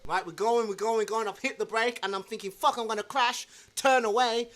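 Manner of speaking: quiet tense inner voice; hushed panic. Retold or imitated. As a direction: mutter the panic to yourself.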